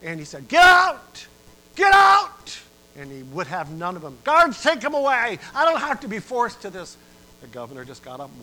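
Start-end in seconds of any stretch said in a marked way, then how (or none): 0.5-2.6 screaming get out, very high and loud; full-throated enraged scream; furious shout. Acted. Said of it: "he just went livid". 4.2-7.0 loud, high and angry; angry prisoner. Acted. Prisoner still raging at governor.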